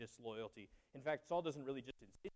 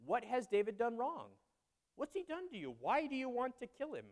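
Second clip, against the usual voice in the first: much higher, urgent questioning; pleading Jonathan; 8 semitones higher, 3 dB louder than usual. Retold or imitated. Jonathan pleading with father Saul, urgent high voice. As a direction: beg your father to explain, voice up high.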